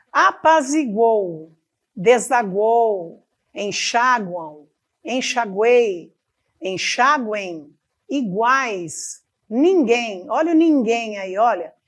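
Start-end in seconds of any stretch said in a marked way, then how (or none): 0.1-10.3 each word slow and carefully spaced; slow example words. Shown as a demonstration. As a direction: read the list slowly for the class. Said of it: "mais palavras sobre tritongo". (palavras ditadas devagar)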